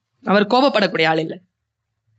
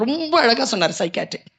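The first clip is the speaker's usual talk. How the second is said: usual speaking voice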